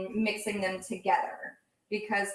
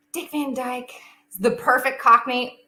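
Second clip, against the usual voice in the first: usual speaking voice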